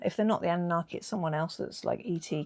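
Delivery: normal talking voice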